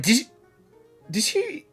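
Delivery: ordinary delivery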